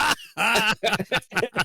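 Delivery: high delighted cackle of disbelief; high burst of laughter. Real, not acted. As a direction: break up laughing at the memory. Laughing at the memory.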